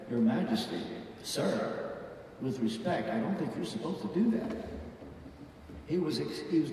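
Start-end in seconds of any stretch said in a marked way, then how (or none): 0.1-4.5 softly voiced, respectful caution to a superior; deferential objection. Someone respectfully correcting King Saul, quiet and deferential. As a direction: lower the voice and object politely.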